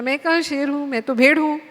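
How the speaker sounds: bleating sheep voice. A narrator's reading. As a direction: bleat the words like a scared little lamb. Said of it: "फिर उसने मिमियाया". (मिमियाती भेड़ की आवाज़ में)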